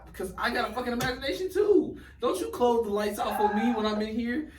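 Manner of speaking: high-pitched, loud protest; animated scared rant. Acted. Guest protesting about lights switched off on him, animated high rant. Ranting about scary movie fears.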